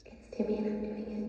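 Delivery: hushed ghost interjection; softly voiced spook cutting in; Timmy the ghost. Ghost cutting into a prank phone call, hushed spooky voice. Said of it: "his name is Timmy".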